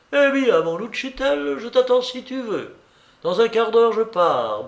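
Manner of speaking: a hint of a teasing young woman; Blanche.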